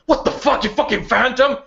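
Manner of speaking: high, furious yell at the phantom; a woman cursing at a ghost; angry outburst. Acted. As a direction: snap at him in shock and rage. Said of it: "she goes".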